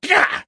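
very high, loud "gah"; startled yelp. Acted. Joe startled by Skippy's avatar, yelping. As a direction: yelp as if caught off guard.

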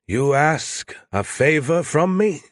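slow, hoarse and scratchy. Acted. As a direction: rasp it slowly like a mob boss. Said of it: "He said that in a hoarse, scratchy voice".

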